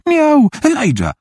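high, drawn-out mock cat cry; high-pitched playful cat imitation; teasing meow. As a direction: meow slowly to tease her. Boy teases girl with cat skull.

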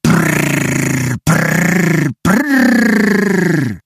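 long rising lip trills, child playing car; lip-trilled engine noise. A narrator's reading. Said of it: "zaczął jeszcze głośniej prykać wargami". (dziecięce warczenie silnika wargami)

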